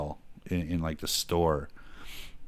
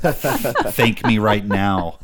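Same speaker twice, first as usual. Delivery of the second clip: very slow and louder; slow mock demand; 4 semitones higher, 8 dB louder, much slower than usual. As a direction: drag each word out, smug. Mock demand after fart.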